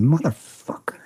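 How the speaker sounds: muttered curse, voiceless under the breath; whispered curse. Retold or imitated. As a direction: hiss it in disbelief, barely audible. Retold curse at negotiator.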